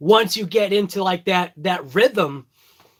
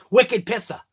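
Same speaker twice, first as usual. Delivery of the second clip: "Wicked pisser" in Boston slang style, pissah; Boston accent; 3 semitones higher than usual. Shown as a demonstration. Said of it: "There's no R".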